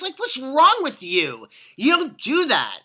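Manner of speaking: high, loud, slow reprimand; exasperated, emphatic dressing-down; indignant scolding. Pretend. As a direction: tell off a bad manager, stretching each word. Scolds the absent management.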